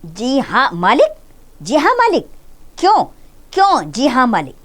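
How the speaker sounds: halting, high, repeating the words in fury; stammering with anger. A narrator's reading. Master sputtering at the maid's meek reply, stammering anger. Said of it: "गुस्से से तुतलाने लगता है".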